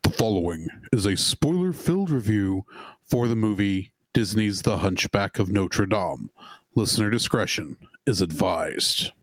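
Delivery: deep formal disclaimer read; announcer voice. Acted.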